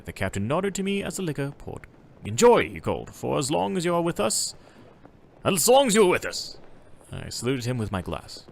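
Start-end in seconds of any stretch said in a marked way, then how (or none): 2.2-2.7 loud and hearty, calling to the guest; called-out toast. Acted. Captain toasting the traveller as the liquor pours, a loud hearty call. 3.1-6.6 loud and hearty, calling to the guest; called-out toast. Acted. Captain toasting the traveller as the liquor pours, a loud hearty call.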